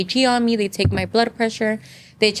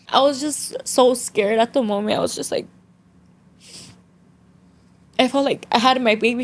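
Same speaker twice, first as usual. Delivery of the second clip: getting emotional; 3 semitones higher than usual. Real, not acted. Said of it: "I'm gonna start getting emotional".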